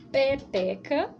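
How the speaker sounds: peteca stretched slow with a rise; slow drawn-out word. Shown as a demonstration. Teacher naming the next picture for children, slowly. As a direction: draw out peteca for the kids. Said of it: "eu tenho aqui, ó, a".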